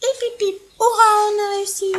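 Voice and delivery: high, joyful mouse cheering; squeaky mouse cheer. Acted. Mice celebrating their success, squeaky. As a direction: cheer it out, tiny and triumphant.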